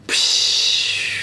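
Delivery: long hissed "psh" whoosh; whooshing smoke burst. Acted. Storyteller imitates the genie bursting from smoke, long whoosh.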